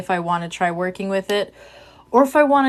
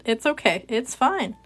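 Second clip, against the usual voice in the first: usual speaking voice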